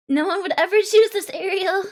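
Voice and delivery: insist, leaning hard on choose; loud emphatic. Shown as a demonstration. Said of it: "No, no one would ever choose this".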